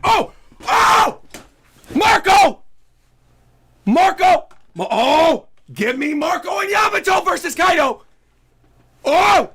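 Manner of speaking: high, loud yelling of Marco; a fan screaming with joy; full-throated hype yell; cheering shout. Real, not acted.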